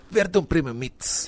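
slow, drawn-out slogan delivery; brand slogan read. Shown as a demonstration. Correcting a friend on the brand name, slow ad-style read. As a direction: say it like an ad tagline. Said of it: "tem que falar assim".

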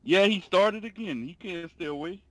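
usual speaking voice

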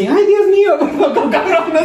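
high, laughing mock panic of a woman; panicked woman. Woman relieved at a narrow escape, high mock squeal. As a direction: squeal it out like a scared lady. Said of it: "La man así".

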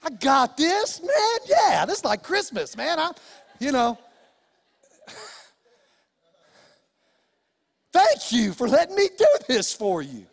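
high, bright, overeager helper voice; eager cheerful kid. Retold or imitated. Dad mimics the dream child happily taking out trash, mock cheer. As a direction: gush like a kid who loves chores. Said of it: "you like it when they go".

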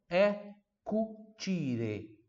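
"cucire" drawn out very slowly, "ci" as chi; drawn-out pronunciation. Shown as a demonstration. Teacher showing how to say it, very slow. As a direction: stretch "cucire" syllable by syllable. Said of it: "o C e o I são de ti".

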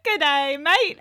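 rising, hammed-up Australian greeting; mock Australian accent. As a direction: attempt British, land on Australian. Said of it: "Oh, that's Australian".

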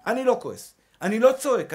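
usual speaking voice

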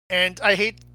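normal talking voice

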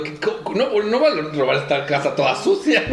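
shocked thief, half laughing; appalled thief. Retold or imitated. Imitating thief's reaction.

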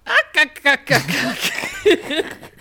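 high, staccato cartoon cackle "ak-ak-ak"; loud Popeye-style chuckle; Popeye laugh. Shown as a demonstration. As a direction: cackle like Popeye in rapid hard bursts. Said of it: "an impression of Popeye".

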